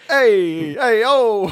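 loud, high Brooklyn hey; Brooklyn accent. Acted. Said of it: "do the rest of the story in a Brooklyn accent".

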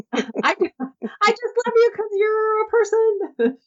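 put-on sweet little girl. Little girl telling a volunteer she loves her. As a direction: say it like an adoring small child. Child's loving words voiced.

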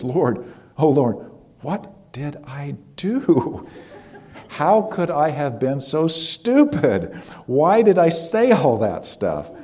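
high, slowed, anguished self-reproach with a rueful laugh; despairing prayer. Retold or imitated. Prisoner in her cell crying out to God, anguished and self-reproaching. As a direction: groan at her own foolishness, up high. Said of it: "She prays".

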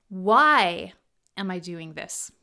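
WHY loud and drawn out, the rest dropping low; stress on WHY. Shown as a demonstration. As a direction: punch "Why", then let the rest fall away. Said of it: "each variation puts the emphasis on a different word".

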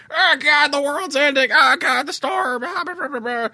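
very high, squeaky mock-alarm headlines; mock panicked news. GM mocks the news headlines in a squeaky panicked voice. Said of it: "news articles about the current situation".

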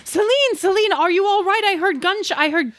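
worried friend after hearing gunshots; panicked Helga. Acted. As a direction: rush in fearing the worst. Helga finds Celine after gunshots.

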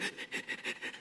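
soft stuttering gasps, imitating choked-up crying; mimicked breathless gasping. Preacher skipping the tears, imitating breathless sobs. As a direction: act out being unable to breathe. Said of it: "I can't breathe".

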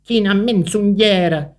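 a mildly cross spider scolding; spider's grumbling. A narrator's reading. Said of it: "a ruganiera aragna da despiete culera".

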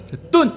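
这顿 thrown up high and cut short; abrupt halt. Shown as a demonstration. Monk showing how to halt mid-sermon, 这顿 high and abrupt. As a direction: snap 这顿 high, then silence. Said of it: "顿住了".

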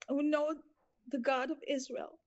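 usual speaking voice

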